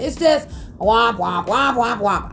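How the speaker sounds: a drooping, mock-gloomy womp womp; sad trombone womp. Mocking the baby paperwork with a deflated womp womp. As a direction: let the womps sag like a losing horn.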